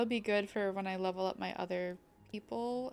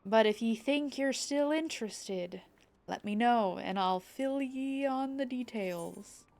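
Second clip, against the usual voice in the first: pirate voice with southern twang; pirate accent; 4 dB louder than usual. Acted. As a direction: read it as a southern-tinged pirate. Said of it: "I was trying to do pirate and it just came out southern".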